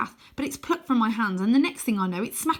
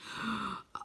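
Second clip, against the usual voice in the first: sharp gasp; 10 dB quieter than usual. Acted. Croaky lands before them.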